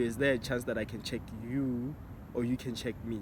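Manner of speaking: usual speaking voice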